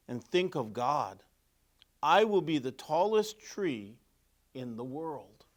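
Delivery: lively storybook narration; reading to children. A narrator's reading. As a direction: read it like a picture book to kids. Reading picture book aloud.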